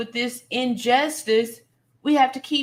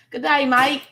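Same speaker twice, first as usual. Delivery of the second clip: attempted Australian accent; 3 semitones higher, 4 dB louder than usual. Livestream host greets a viewer from Perth, attempted Australian accent. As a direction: try on an Australian accent, badly but cheerfully.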